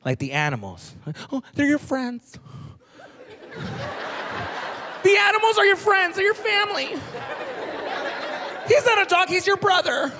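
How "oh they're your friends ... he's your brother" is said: high, sing-song teacher voice; sweet teacher. Retold or imitated. American teacher telling kids animals are friends, sweet. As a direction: gush like a kindergarten teacher.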